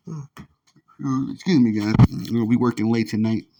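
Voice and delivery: talking through a yawn; words stretched by a yawn. Real, not acted. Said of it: "please excuse the yawning".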